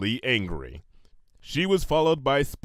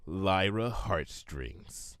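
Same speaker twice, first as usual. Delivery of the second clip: softer, slow breathed name; 6 semitones lower, 7 dB quieter, much slower than usual. A narrator's reading. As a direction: breathe it with cold rage. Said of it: "she breathed".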